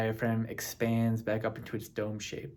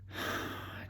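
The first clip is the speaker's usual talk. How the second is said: soft audible breath out; deep sigh; 10 dB quieter than usual. Shown as a demonstration. Meditation leader breathing before saying relax, soft sigh. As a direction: let a long, quiet breath go.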